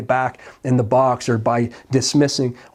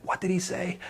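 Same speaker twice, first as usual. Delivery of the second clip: a high, soft lean-in question; hushed question; 6 semitones higher, 11 dB quieter than usual. Retold or imitated. Crowd at the tomb straining to hear, hushed.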